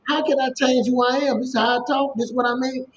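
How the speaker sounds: imitating his younger defensive self. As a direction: replay your old self swearing you did nothing wrong. Retelling his protest about how he talks.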